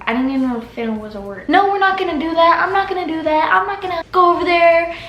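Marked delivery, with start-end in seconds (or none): 1.5-4.9 higher, quick, proper "we're not gonna"; corrected brother line in plain words. Retold or imitated. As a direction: redo the refusal without "finna", high and quick. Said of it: "we didn't talk like this".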